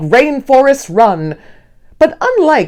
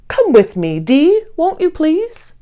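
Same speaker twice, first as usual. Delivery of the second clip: rising higher into a sweet plea on please; pleading Hank; 8 semitones higher than usual. Acted. Hank begs his friend Dee to join, pleading. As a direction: coax a friend to come along. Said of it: "Hank claims".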